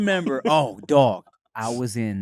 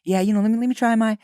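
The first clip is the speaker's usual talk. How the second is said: mock Australian voice pitched higher; Australian accent; 8 semitones higher than usual. Mocking UK and Australian people trying an American accent, higher voice. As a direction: play a Brit or Aussie about to try American. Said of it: "Australian people be like".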